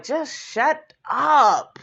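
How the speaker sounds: a slow, drawn-out exasperated "Just shut up". Retold or imitated. Vlogger telling Mayetta off, slow and exasperated. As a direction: stretch "Just shut up" out slowly like you're fed up. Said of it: "I was just like".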